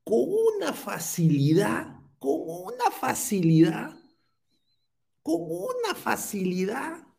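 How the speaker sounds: high-pitched imitation with stretched words; sing-song high mimicry; Sandro Centurión. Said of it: "como diría Sandro Centurión".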